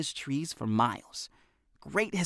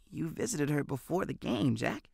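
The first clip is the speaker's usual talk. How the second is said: soft, serious, no humor; spoke softly; 3 semitones higher, 8 dB quieter than usual. A narrator's reading. Benny speaks softly to Jack about his mom, no hint of humor. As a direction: drop the joking, say it gently. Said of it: "He spoke softly now".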